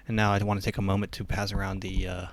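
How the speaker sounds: fast, smooth preacher patter ending loud; mock worship leader. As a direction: rush it like a pastor asking for money. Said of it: "he's like".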